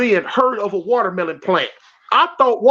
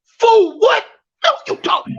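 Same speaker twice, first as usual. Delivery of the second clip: snapping back; 10 semitones higher than usual. Retold or imitated. Inmate snapping at the guard who called him boy, high angry snap. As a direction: snap right back at him, pitch jumping up. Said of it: "I snapped".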